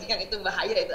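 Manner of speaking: usual speaking voice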